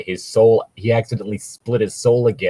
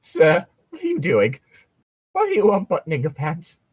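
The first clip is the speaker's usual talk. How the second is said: higher, badly acted alarmed voice; bad put-on voice; 6 semitones higher than usual. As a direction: ham up a shocked stranger, badly. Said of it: "That was bad".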